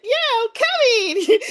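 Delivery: high, loud happy yes; eager reply. Retold or imitated. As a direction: answer with bouncy enthusiasm. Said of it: "I was like".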